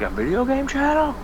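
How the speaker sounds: incredulous quote. Retold or imitated. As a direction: squeak it out in mock disbelief. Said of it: "I was like".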